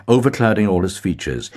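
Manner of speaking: usual speaking voice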